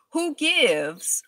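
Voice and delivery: ordinary talk, nothing marked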